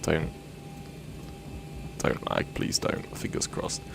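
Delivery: softly whispered begging; whispered plea. Real, not acted. Streamer begging his lagging computer, whispered plea. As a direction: hush and plead with the computer.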